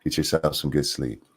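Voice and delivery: put-on soft, low goodnight to a child; sweet voice. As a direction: tuck him in gently. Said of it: "With your sweet voice".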